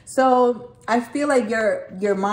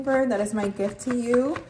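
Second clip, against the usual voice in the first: ordinary delivery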